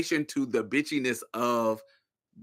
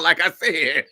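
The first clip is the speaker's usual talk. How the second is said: ordinary delivery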